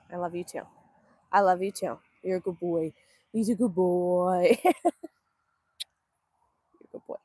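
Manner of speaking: low, cooing pet voice; affectionate dog talk. Real, not acted. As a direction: soothe a beloved dog with low sweet talk.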